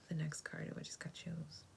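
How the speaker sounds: usual speaking voice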